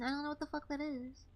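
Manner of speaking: normal talking voice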